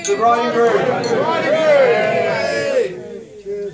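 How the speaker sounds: loud rising toast call; toast shout. Real, not acted. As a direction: shout it out to the room.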